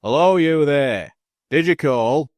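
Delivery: loud call across the churchyard; shouted. Acted. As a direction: shout out into the dark. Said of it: "Andrew shouted".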